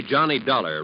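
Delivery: usual speaking voice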